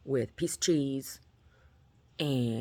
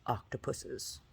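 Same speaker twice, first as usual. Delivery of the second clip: whisper; 8 dB quieter, much slower than usual. Real, not acted. Whispered correction aside.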